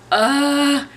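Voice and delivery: mock-panicked yelp mid-contraction; retold panic. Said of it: "I was so scared".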